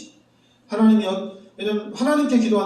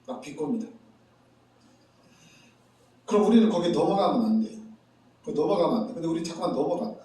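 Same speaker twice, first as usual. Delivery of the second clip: usual speaking voice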